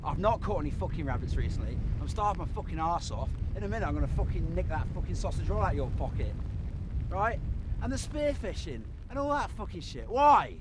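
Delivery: loud, bitter complaining; angry rant. Real, not acted. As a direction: vent your hunger and failure at them. Ranting at crew about failing.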